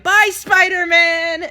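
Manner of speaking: high, loud, drawn-out goodbye; kids calling out. Retold or imitated. Kids leaving in a van, imitated shout. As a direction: holler it slowly like kids from a window. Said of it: "and they're like".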